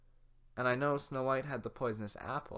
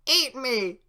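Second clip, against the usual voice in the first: defiant cookie shout; 11 semitones higher, 12 dB louder than usual. Acted. Gingerbread man defiant.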